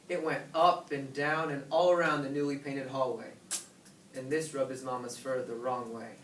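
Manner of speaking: ordinary delivery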